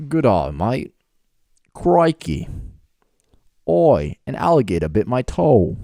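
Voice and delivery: hammed-up Aussie accent; Australian accent. Shown as a demonstration. Friend asks to hear it, performs a mock Australian accent. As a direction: put on a cartoonish Australian accent. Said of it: "let's hear your Australian accent".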